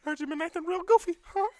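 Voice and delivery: very high, loud cartoon squeak; piercing falsetto mock threat; squeaky mouse threat.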